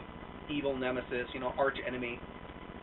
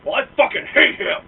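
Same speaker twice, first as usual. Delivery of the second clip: loud, high mock yell of hatred; angry outburst; 10 semitones higher, 13 dB louder than usual. Stranger yelling his hatred in a loud mock rant. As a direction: shout it like the furious stranger. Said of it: "And he goes".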